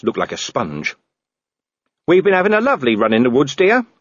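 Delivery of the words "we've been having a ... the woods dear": brisk, cheery high lady; breezy and fast, all is well; Sybil's voice. A narrator's reading. Sybil calling to her husband, brisk and cheery.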